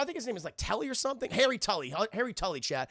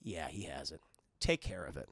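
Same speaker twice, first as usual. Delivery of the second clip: very low, soft villain voice; cold low murmur to a henchman; sinister boss; 13 semitones lower, 9 dB quieter than usual. Retold or imitated. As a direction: drop to a deep, quiet, menacing order.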